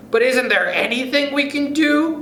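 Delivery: high, pleading and panicky; desperate cry. Acted. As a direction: beg for help in fright. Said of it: "cried Missus Twit".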